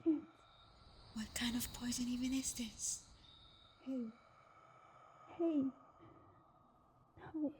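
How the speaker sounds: panicked voiceless question; anxious hushed muttering; frightened whisper. Acted. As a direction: fear breaking through in a whisper. Discovering poison, panicking.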